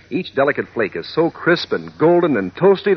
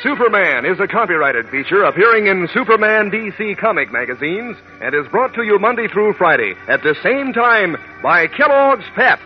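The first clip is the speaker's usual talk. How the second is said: higher formal sponsor announcement; old radio announcer; 7 semitones higher than usual. Acted. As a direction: read the credits like a broadcast. Sponsor credit read.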